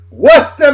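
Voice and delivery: Worcester said as WOOS-tuh, r dropped; local pronunciation. Shown as a demonstration. Proud masshole teaching folks to say Worcester as WOOS-tuh. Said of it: "that's how you pronounce it".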